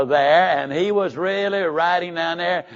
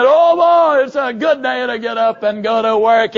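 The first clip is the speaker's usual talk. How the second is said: loud, high cry that sags into the line; half-asleep but pushing the enthusiasm; sleepy forced cheer; 4 semitones higher, 5 dB louder than usual. Shown as a demonstration. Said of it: "And you're saying".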